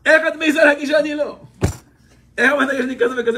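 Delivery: husband snapping at his wife for her criticism; indignant outburst. Retold or imitated. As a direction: fire it back at the wife, wounded pride. Husband's indignant reply in quarrel.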